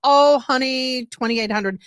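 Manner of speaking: usual speaking voice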